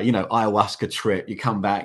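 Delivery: ordinary talk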